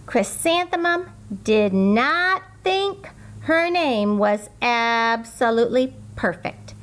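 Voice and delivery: ordinary delivery, nothing marked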